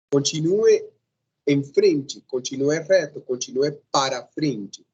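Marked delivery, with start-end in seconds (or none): none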